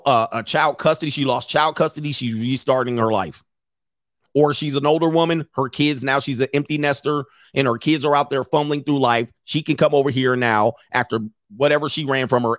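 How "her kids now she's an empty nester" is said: ordinary talk, nothing marked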